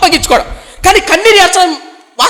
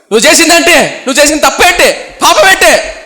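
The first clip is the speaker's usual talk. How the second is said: higher, louder accusing questions; rising rebuke; 6 semitones higher, 4 dB louder than usual. Retold or imitated. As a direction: press him on his sin, voice climbing. Samuel rebukes King Saul. (పెద్ద స్వరంతో నిలదీస్తూ)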